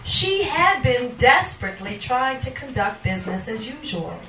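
deliberate, theatrical recitation with long pauses; dramatic poetry reading. Acted. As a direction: recite it as a dark monologue. Reads a poem aloud.

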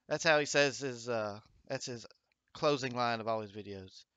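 normal talking voice

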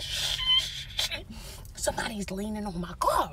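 sharp shh, then a hushed warning; frantic whispered shushing; urgent hushing whisper. Retold or imitated. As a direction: hush her before he hears. Hushing friend, man at car.

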